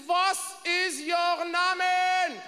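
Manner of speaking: put-on very slow, drawn-out German-accented question; slow German demand. Stewardess slowly demanding his name in a heavy German accent. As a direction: stretch every word like an interrogator. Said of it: "She says".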